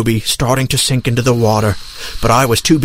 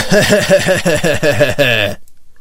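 long staccato villain cackle; practised evil laugh. Acted. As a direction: try out an evil laugh on orders. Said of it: "Now practice your evil laugh".

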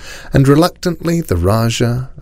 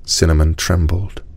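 plain reading voice

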